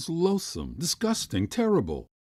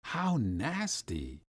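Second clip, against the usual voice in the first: quieter voiced repeat, drawn out; soft, slow disgust; 5 dB quieter, much slower than usual. A narrator's reading. Boy repeating his disgust at oysters, softly. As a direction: let the disgust sink in softly. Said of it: "I whispered".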